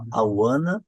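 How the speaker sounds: slow demo of joined "wanna"; "want to" merged into "wanna", rising; linked reduction. Shown as a demonstration. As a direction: model "I wanna" slowly for learners. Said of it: "então ficou aqui".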